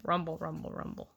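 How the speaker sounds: low, soft rumble fading away; rumbling sound effect. A narrator's reading. Narrator imitating Travis's rolling pumpkin, low fading rumble.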